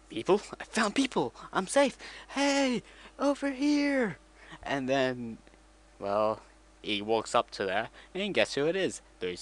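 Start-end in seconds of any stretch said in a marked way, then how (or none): 0.1-4.2 much higher, calling out with joy; relieved excited C-3PO. Retold or imitated. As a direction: shout for rescue, thrilled and relieved. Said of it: "he's like".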